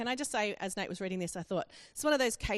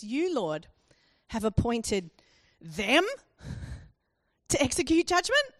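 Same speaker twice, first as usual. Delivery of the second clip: "them" punched louder, "judgment?" swept up high and loud; disbelieving question, pausing after "them", rising on "judgment"; stress and questioning rise; 3 semitones higher, 5 dB louder than usual. Shown as a demonstration. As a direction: hit THEM, then lift JUDGMENT into a question. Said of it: "There needs an emphasis on the them and a question mark rising inflection".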